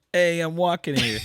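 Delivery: high, loud East Coast heckle; East Coast accent. Retold or imitated. Imitating his drunk buddy at the game, loud East Coast accent. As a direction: holler it like an East Coast pedestrian. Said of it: "we do a pretty good East Coast accent".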